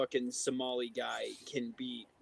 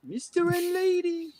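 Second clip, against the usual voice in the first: very high, slow cartoon voice; squeaky drawn-out cartoon character; Tanji voice. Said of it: "have you seen that American Dad with Tanji".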